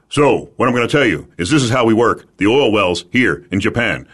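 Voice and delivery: lightly put-on Japanese English; slight Japanese lilt on a business pitch; a touch of a Japanese accent; mock Japanese accent. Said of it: "That's how I talk".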